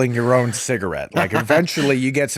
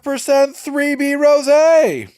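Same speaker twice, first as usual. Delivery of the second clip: high, loud stand-up complaint, last words slow; Jerry Seinfeld impression; 14 semitones higher, 6 dB louder than usual. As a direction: do a Seinfeld observational bit. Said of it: "a Jerry Seinfeld thing going on".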